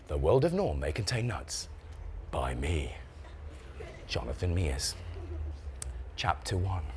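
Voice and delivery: low, soft, solemn book opening; hushed title reading. Acted. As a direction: begin the book with quiet gravity.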